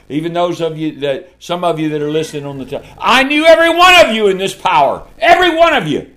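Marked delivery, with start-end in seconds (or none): 3.0-5.1 higher and louder, full preaching force; preaching shout. Real, not acted. Repeated, now shouted. 5.2-6.1 high, loud preaching cry; shouted emphasis. Real, not acted. Repeated, shouted.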